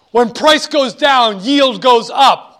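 slow and loud, each word weighted; slow emphatic principle. Shown as a demonstration. Teacher repeating the rule to students, slow and emphatic. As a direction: drill the rule into them.

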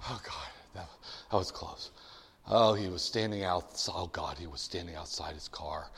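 low, soft mutter after a near miss; hushed, mock-shaken relief; acted relief. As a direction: murmur it to yourself, pitch dropped.

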